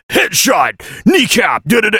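gruff action hero announcer; deep manly video game voice. Retold or imitated. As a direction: bark it like a game character. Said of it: "manly guy video game character voice".

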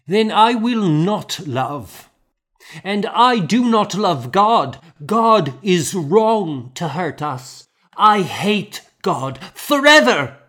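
put-on high, loud little girl, angry and hurt; a child lashing out at God; defiant grieving child. A narrator's reading.

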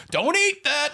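high loud stepdad yell; pull string toy. Acted. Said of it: "Johnny Stepdad ... thirteen different sayings".

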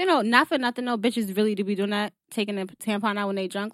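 ordinary talk, nothing marked

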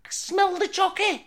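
put-on high, slow silly Scouse accent. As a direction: sing it out in a daft high Scouse voice. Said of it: "that accent".

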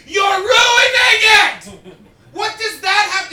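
sudden high yell, drawn out; mock outraged yell. Comic telling the crowd he nearly yelled at the DJ, huge fake outrage. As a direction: scream it like your song is being wrecked. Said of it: "not to go".

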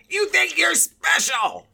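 high, loud, drawn-out yell; mock scornful shout. Older shopper's parting shot as he leaves, mock scornful shout. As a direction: imitate an outraged man's last jab.

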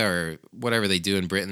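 usual speaking voice

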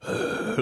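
an unvoiced, heavy wheezing gasp for air; imitated wheezing gasp. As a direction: mimic the fat teacher's labored breathing. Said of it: "breathe that way".

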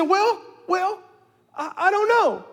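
put-on high, hesitant child's voice; unsure little girl. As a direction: stammer like a caught kid.

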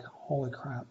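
quietly stunned, under the breath; stunned murmur. Retold or imitated. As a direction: say it low, as if floored. Stunned at colleague's simple solution.

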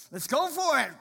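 high, loud gung-ho call; eager go-getter. Retold or imitated. Man quoting his own motto, fired up. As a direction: pump yourself up to charge in.

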